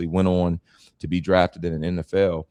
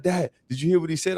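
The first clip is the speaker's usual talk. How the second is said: put-on much higher, fast starstruck kid voice; awed kid; 10 semitones higher, faster than usual. Young son asking his father after meeting a star, excited high voice. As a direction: play the son, amazed and breathless. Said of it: "he turns to me like".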